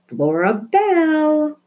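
high, slow, stretched call of the full name; mother's drawn-out shout. A narrator's reading. Mother calling Flora Belle up the stairs, high and drawn out. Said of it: "her mother shouted".

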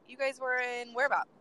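ordinary talk

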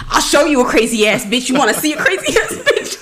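high-pitched, hammed-up teenage challenge; playful mock outrage; mock threat. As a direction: act out a kid picking a fight, laughing by the end. Acting out her teenage threat.

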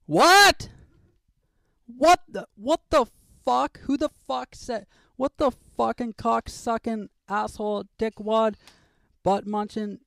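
high, loud screaming fury; furious rant. Acted. Host screaming after hearing Scarlett Johansson is engaged, furious. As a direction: lose it completely, yelling.

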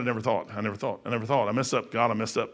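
low, soft, repeated confession; quiet remorse. Acted. King admitting his mistake to God, low remorseful murmur. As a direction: confess it softly, kicking yourself.

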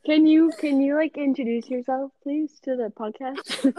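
mocking a girl's voice. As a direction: tease her by copying how she talks. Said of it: "That is not how I talk. He was mimicking".

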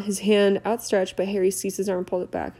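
plain reading voice

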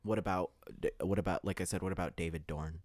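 ordinary delivery

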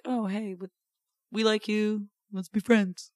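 mock friend-making voice, slightly ducky; quirky improv voice. Retelling how improv groups befriended each other, lightly put-on voice. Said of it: "Is that how you talk".

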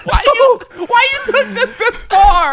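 very high, loud, drawn-out plea; mock protest. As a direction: pitch it way up in hammed-up dismay. Mock wounded reply to the insult.

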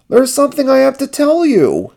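very high, urgent character voice; high-pitched anxious puppet; Ernie's voice. A narrator's reading. Ernie urgently tries to confess.

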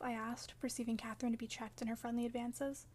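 usual speaking voice

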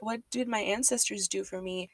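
ordinary talk, nothing marked

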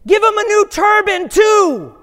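high, loud, eager shout; bursting with excitement, shouting high and loud; excited shout. Acted. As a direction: blurt it out at the top of your voice. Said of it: "he says".

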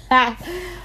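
quick embarrassed giggle; a little self-conscious laugh; short laugh. Real, not acted. Laughs at her own fumbling.

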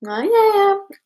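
high, offhand brush-off; a doubting dad waving her off; dismissive dad. Retold or imitated. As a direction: imitate the dad humoring her. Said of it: "he's like".

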